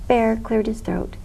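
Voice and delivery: ordinary delivery, nothing marked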